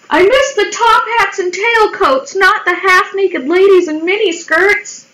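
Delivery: high-pitched mocking read-out; mocking quote. Mocking a dumb quote read online.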